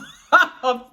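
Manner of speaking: loud burst of laughter. Real, not acted. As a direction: laugh out loud at own story. Laughing mid-story.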